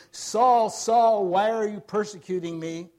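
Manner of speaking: thunderous retold accusation; booming heavenly call. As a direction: call out the name urgently, imitating a divine voice. Heavenly voice calling Saul in retelling.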